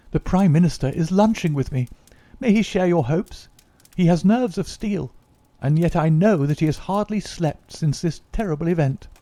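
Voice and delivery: put-on a young politician full of hope; eager young secretary.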